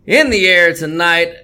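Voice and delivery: ordinary talk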